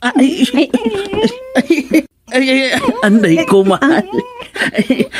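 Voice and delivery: high wailing "ay, ay" that tips into laughter; mock wail turning into giggles; crying then laughing. Husband wails, then laughs.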